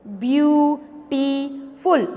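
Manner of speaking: "Beautiful" very slowly in three beats; view, टी, फुल, a pause between each in "beautiful"; split into syllables. Shown as a demonstration. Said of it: "clap करके बता रही हूँ".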